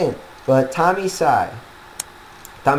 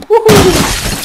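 high whooping cheer; excited cheer; 6 dB louder than usual. Real, not acted. Cheering at game success.

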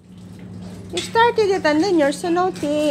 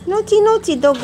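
higher, louder sing-song scold; mock-stern pet talk; playful scolding; 6 semitones higher, 4 dB louder than usual. Real, not acted. Scolding the hurt dog.

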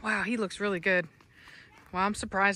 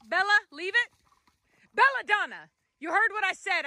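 higher, stern command to the dog; firm scolding; 8 semitones higher than usual. Real, not acted. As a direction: tell the dog off firmly.

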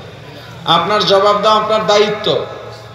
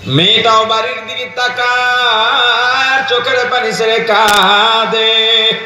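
raised sing-song sermon chant; melodic preaching; 3 semitones higher than usual. Real, not acted. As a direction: preach it in a chant, stretching the line endings. (সুর করে ওয়াজ, টেনে টেনে)

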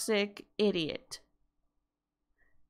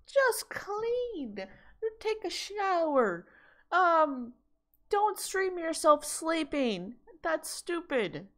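much higher, exasperated mock lecture; exasperated scolding; 8 semitones higher, slower than usual. Telling the streamer what to do in a high, exasperated voice. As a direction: scold her in a high, fed-up voice. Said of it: "even I'm like".

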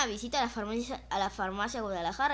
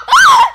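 very loud high laughing scream; scared scream; 23 dB louder than usual. Real, not acted. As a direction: let out a startled laughing shriek. Scream at cat.

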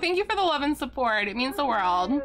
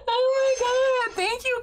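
overwhelmed, near tears; 12 semitones higher, 3 dB louder than usual. Real, not acted. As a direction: let the joy crack into tears. Overwhelmed by chat's support.

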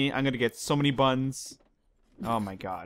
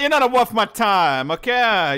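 friendly threat; 8 semitones higher, 7 dB louder than usual. Pretend. Playful mobster quarrel.

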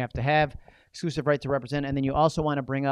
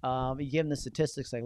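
ordinary talk, nothing marked

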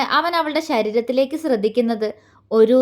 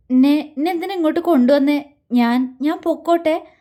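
usual speaking voice

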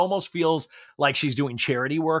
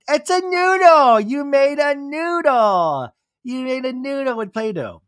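put-on excited toddler; 10 semitones higher, 4 dB louder than usual. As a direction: squeal it like a thrilled small child. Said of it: "Summer Moon's like".

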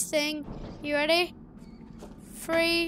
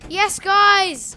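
louder, higher joyful shout; relieved cheer; 5 semitones higher, 12 dB louder than usual. Real, not acted. Gamer reaching a save point, relieved loud cheer. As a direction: whoop with relief.

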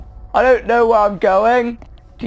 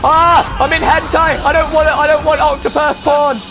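panicked outcry; 6 semitones higher than usual. Real, not acted. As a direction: cry out in alarm, easing slightly. Panicking at monster in game.

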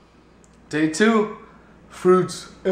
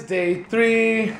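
ordinary delivery

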